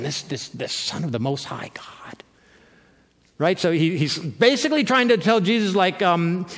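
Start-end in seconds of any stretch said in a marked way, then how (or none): 0.0-1.9 hushed, unvoiced awe at the title; awed whisper. Demon whispering in awe of Jesus' title. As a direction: breathe the title like a frightened demon.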